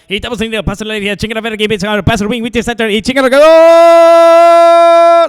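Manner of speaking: fast, loud Spanish play-by-play building to a long held high yell. Retold or imitated. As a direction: rattle it off then stretch the last call way up. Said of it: "stereotypical Mexican announcer like".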